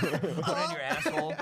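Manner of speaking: high and loud through laughter; laughing. Real, not acted. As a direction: blurt it while cracking up. Joking banter, laughing.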